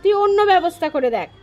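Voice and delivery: brisk, sharp voice of a stern father telling his son off; blunt father's rebuke. Acted. Father bluntly refuses his son a place at home, briskly scolding. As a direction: rattle it off like a father turning his son away. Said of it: "সোজা বলে দিলেন বাপ".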